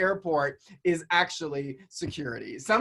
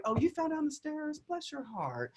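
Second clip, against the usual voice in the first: "bless your heart" said sweetly but meaning you're an idiot; syrupy mock sympathy hiding an insult; fake sweet Southern put-down; 10 semitones higher, 7 dB quieter than usual. Shown as a demonstration. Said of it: "what it means is like".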